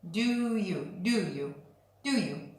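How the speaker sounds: "Do you" run together as one quick sound; linked do you. Shown as a demonstration. Teacher drilling students on linked "do you". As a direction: glide "do you" smoothly into one unit. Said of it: "You can hear the do and you go together".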